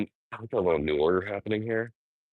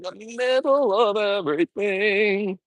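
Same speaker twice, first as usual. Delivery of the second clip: exasperated; 12 semitones higher than usual. Real, not acted. Annoyed at pedestrians.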